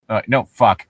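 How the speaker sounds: ordinary delivery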